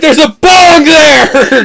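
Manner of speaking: high, drawn-out shout; mock alarmed yell. Friend recalling the broken bottle, hammed-up yell. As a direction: yell it out slowly, well over the top.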